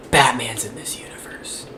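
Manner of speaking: whispered mock reveal; mock stage whisper. Co-host mocking a too-direct name-drop, in a mock whisper. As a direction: drop it in a hushed, teasing whisper.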